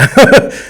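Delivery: sudden loud laugh; burst of laughter. Real, not acted. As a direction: laugh out loud, high and bright. Laughing at wife's complaint.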